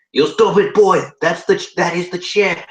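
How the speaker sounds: loud, high mock-Chinese scolding; thick Chinese accent. Manager scolding a confused newcomer, thick Chinese accent. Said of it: "he replied in a thick Chinese accent".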